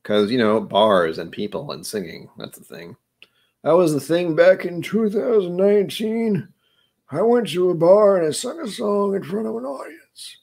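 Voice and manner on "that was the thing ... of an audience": put-on high, wavering old man voice. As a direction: talk like a creaky old man. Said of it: "That's my old man voice".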